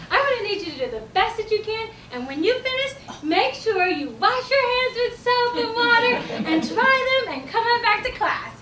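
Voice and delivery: high, sing-song instructions to a little one; coaxing teacher. Acted. Teacher instructs a small child, sing-song and encouraging.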